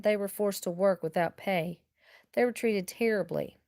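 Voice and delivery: ordinary delivery, nothing marked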